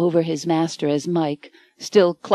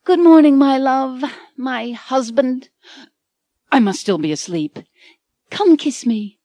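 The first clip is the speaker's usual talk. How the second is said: higher, tender loving heroine voice; dreamy Anne; 6 semitones higher, 4 dB louder than usual. A narrator's reading. Anne greeting Michael in the morning, higher dreamy heroine voice. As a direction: coo it to your husband.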